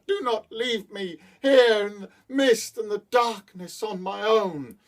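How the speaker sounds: put-on high, pleading young woman's voice; pleading lady. A narrator's reading. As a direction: beg him sweetly from the bridge. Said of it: "Soon she called out to him".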